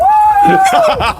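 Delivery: a sudden loud, high burst of laughter. Real, not acted. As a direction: let out a big squealing laugh.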